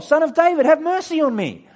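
high, full-force shout; loud, high-pitched cry for mercy. Acted. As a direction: shout desperately to be heard over everyone. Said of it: "He shouted all the more".

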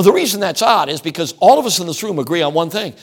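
ordinary delivery

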